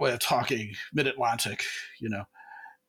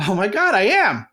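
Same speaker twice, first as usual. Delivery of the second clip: louder, self-satisfied "I am"; man agreeing he's funny, louder and smug; smug self-realization; 5 semitones higher, 5 dB louder than usual. Retold or imitated. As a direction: puff up, proud of yourself. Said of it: "And you'll go".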